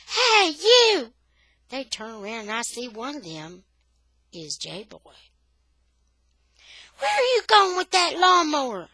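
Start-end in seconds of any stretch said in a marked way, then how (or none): 0.1-1.1 high, loud call down the street; yelling. A narrator's reading. Boy calling after lawnmower thieves, yelling. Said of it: "I yell". 7.0-8.9 loud, high challenging shout; yelling. A narrator's reading. Boy challenging two boys taking the lawnmower, yelling. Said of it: "I yell".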